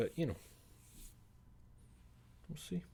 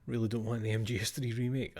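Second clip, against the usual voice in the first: ordinary talk, nothing marked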